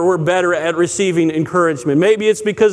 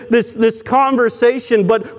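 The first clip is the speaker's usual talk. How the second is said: ordinary delivery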